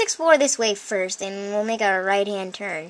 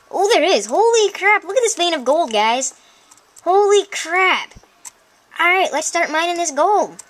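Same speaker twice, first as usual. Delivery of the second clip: high, loud amazed exclamations; excited discovery; 7 semitones higher, 3 dB louder than usual. Real, not acted. Player shows viewers a vein of gold, amazed. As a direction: burst out high at the find.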